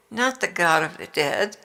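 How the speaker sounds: ordinary delivery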